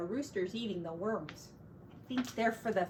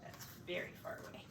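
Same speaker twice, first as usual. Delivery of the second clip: hushed whisper; 15 dB quieter, faster than usual. Real, not acted. Parent whispering to child about the picture, soft whisper. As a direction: whisper so as not to break the calm.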